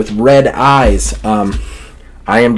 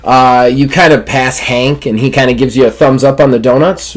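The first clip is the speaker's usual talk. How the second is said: normal talking voice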